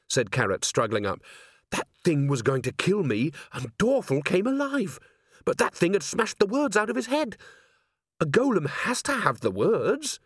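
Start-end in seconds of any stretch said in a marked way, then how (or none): 1.7-10.2 high, swooping excited voice; worked-up protest rising higher; agitated insistence. Acted. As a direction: insist in amazement, pitch climbing high. Carrot insists Dorfel came alive.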